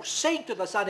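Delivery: ordinary delivery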